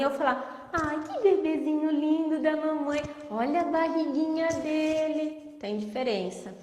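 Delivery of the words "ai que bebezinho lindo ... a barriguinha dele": high, sing-song voice with clear words; sweet intonation. Shown as a demonstration. Therapist shows correct playful tone to a baby, high and sing-song. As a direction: talk sweetly to the baby, each word clear. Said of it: "É entonação".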